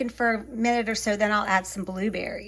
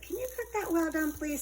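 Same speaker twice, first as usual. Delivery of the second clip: higher-pitched polite ordering voice; polite diner request; 6 semitones higher, 5 dB quieter than usual. Retold or imitated. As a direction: lightly act out asking the waiter. Voices her usual restaurant request.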